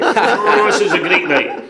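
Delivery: put-on high, loud, laughing drunken glee; tipsy laughing cheer; drunk delight. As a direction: gush like a happy drunk. Retelling his drunk self.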